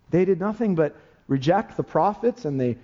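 ordinary delivery

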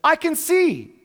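high, excited cartoon voice; character's line. Preacher quoting a cartoon character, high and thrilled. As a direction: squeal it out like a kid's show character. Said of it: "And he says".

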